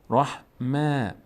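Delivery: "رحمه" slowly, ending in a thin, fronted long "mae"; light meem. Shown as a demonstration. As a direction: stretch "رحمه" with a thin last vowel. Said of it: "پر خوانده نمیشه". («رحمه» کشیده با میم باریک)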